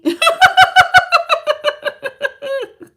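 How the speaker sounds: high, loud cackling laugh; real laughter bursting out. Real, not acted. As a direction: let a big laugh burst out. Laughing at viewer's name.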